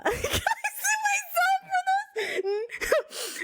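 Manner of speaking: high, slow words squeezed through laughter; laughing helplessly. Real, not acted. Laughing at herself while pleading to the judge. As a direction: laugh so hard the words break. Laughing at her own outburst.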